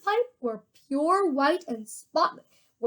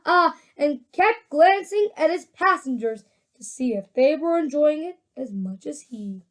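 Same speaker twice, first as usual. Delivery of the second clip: ordinary delivery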